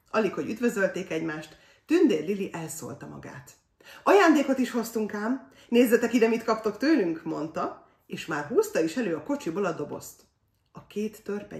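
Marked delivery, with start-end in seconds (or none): 4.0-7.2 high, loud, eager announcement; excited announcement. A narrator's reading. Fairy girl shows the dwarfs a present, excited announcement. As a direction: burst out with the big surprise.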